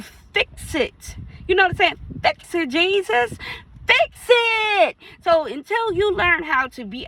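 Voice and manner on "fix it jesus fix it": preaching plea. Real, not acted. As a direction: cry out to Jesus to fix it.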